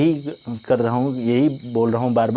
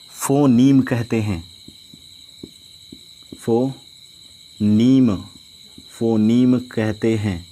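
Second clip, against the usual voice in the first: slow, with long pauses between phrases; slow dictation; much slower than usual. Shown as a demonstration. Teacher dictating a definition to students, slow and spaced. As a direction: dictate it phrase by phrase so they can write. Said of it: "मैं अगर इसकी definition लिखूं".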